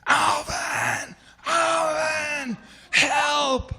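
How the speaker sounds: long, high, full-voiced call across water; panicked yell for help. Swimmer calls to his buddy for help, loud drawn-out yell. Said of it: "I yell".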